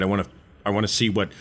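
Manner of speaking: ordinary talk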